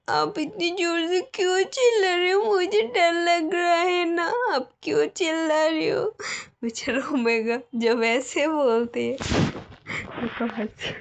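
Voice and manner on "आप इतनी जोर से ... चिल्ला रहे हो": higher, frightened, close to tears; scared whimper. Acted. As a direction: shrink from her shout, near crying. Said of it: "तो omega डर जाते". (डरी हुई, रुआँसी ऊँची आवाज़)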